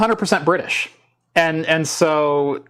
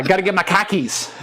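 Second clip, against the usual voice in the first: dropped-r Boston twang, pitched up; Boston accent; 4 semitones higher than usual. Retold or imitated. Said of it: "where the Boston accent came from".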